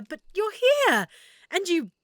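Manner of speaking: high, loud burst of relief; excited relief; overjoyed. Acted. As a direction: blurt it out, thrilled they made it. Relieved friend finally sees adventurer.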